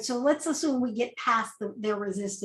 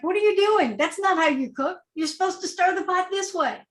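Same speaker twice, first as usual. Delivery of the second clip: high, bossy meddling mother-in-law voice, pitched up; nagging mother-in-law; 7 semitones higher than usual. Retold or imitated. Mother-in-law scolds the cook in his own kitchen, high and bossy. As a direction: play the pushy relative correcting your cooking. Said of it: "They're like".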